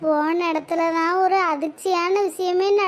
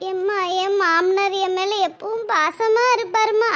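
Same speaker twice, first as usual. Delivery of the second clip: ordinary delivery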